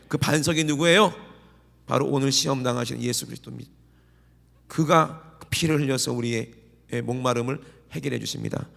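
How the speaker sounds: ordinary talk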